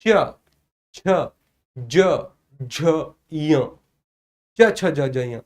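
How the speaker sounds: च छ ज झ य each said slowly and lengthened, with a slight friction; teacher shows the class each च, छ, ज, झ, य, sounding it out long; drawn-out palatal sounds. Shown as a demonstration. Teacher to class: demo च छ ज झ य, drawn-out sounds. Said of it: "चा वर्ग को पहले बोलकर देखो".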